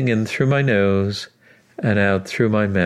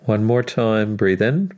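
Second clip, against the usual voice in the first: guided breathing cues; 3 dB quieter than usual. Acted. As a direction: count the breaths gently, unhurried.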